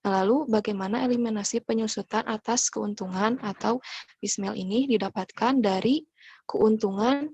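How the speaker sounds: usual speaking voice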